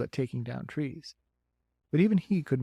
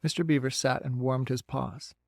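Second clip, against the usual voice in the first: usual speaking voice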